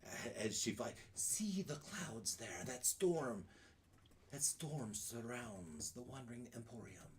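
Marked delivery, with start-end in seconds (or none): 1.1-7.1 put-on old hag. Hag points out storm.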